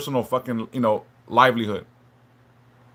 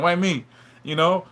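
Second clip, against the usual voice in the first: usual speaking voice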